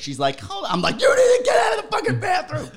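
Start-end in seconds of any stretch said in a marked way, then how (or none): 0.4-0.7 much higher, softer voice; high old lady. Retold or imitated. Old lady calling from the bathroom, high and soft. 1.0-2.7 much higher, louder yell; screaming. Retold or imitated. Yelling at the old lady to leave the bathroom, high and loud. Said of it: "Like, screaming".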